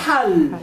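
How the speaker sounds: "Hal" with a tight Arabic ح from deep in the throat; low "Hal", Arabic ḥ as in حل; pharyngeal h. Shown as a demonstration. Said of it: "tres palabras con ja y ja y ja".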